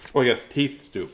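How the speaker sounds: usual speaking voice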